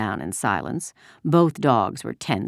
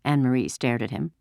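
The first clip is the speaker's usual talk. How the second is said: usual speaking voice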